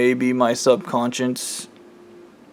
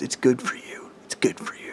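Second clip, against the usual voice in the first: hushed, voiceless whisper; very soft, chilling whisper; acted menacing whisper; 10 dB quieter than usual. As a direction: whisper it to a victim. Killer's cold line to the mother.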